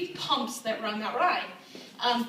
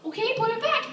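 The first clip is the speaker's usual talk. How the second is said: put-on high-pitched exasperated order to a child; strained patience, pitched way up; getting mad; 10 semitones higher than usual. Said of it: "I'm getting so mad".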